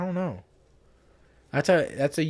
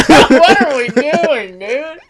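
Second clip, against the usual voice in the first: high-pitched laughing words; 11 semitones higher, 13 dB louder, slower than usual. Real, not acted. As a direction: crack up while asking. Cracking up mid-sentence.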